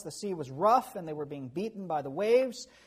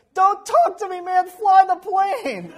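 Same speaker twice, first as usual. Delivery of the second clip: panicked yell; 12 semitones higher, 14 dB louder, slower than usual. Retold or imitated. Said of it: "he goes".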